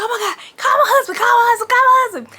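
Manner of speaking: high, fast, crying yell; frantic plea. Retold or imitated. Stranded woman begging her friend to call husband, frantic. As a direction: beg in a panic, voice cracking. Panicked plea after crash.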